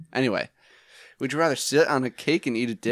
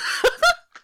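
squealing laugh; laughing hard at own joke; high burst of laughter; 6 dB louder than usual. Real, not acted. After punchline.

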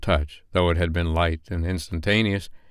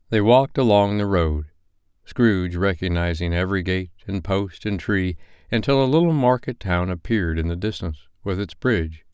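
ordinary delivery, nothing marked

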